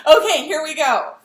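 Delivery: ordinary talk, nothing marked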